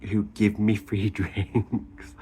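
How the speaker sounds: words breaking up into real laughter; slow start giving way to a laugh. Real, not acted. Vlogger admitting people give her free drinks, laughing. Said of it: "I'm laughing because".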